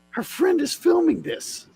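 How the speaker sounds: high-pitched disbelief. Real, not acted. Repeats line in disbelief.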